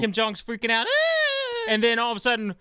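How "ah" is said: high mock scream of panic. Kim Jong hearing the shot, with a hammed-up scream. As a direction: let out a hammed-up yelp. Retold character freaking out.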